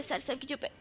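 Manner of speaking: dropped almost to a murmur; quiet mumbled aside. Real, not acted. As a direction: say it under your breath. Mumbled, then repeated louder.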